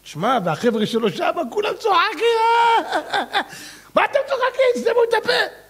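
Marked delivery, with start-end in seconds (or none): 1.5-5.7 high laughing lines. Acted. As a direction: laugh through the scolding. Friends laughing in story.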